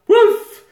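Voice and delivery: loud, high imitation woof; dog bark. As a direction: bark out one sudden doggy woof. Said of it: "He's dressed as a fucking dog".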